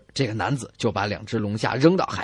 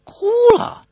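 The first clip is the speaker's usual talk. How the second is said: much higher and louder startled question; surprised; 9 dB louder than usual. Real, not acted. Host reacting to the crying wife, loud surprised question. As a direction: jump up in surprise at the news.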